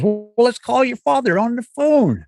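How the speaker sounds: high, slow put-on Cosby voice; slow high mock Cosby drawl; Bill Cosby impression. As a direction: drawl it out like Cosby with a big pitch lift. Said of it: "And Bill Cosby goes".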